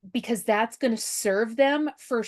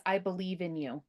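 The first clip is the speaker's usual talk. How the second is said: softer, tender reassurance; gentle encouragement; 3 semitones lower, 7 dB quieter than usual. Acted. Parent's words to a kid, gently encouraging. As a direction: say it softly and sincerely to your child.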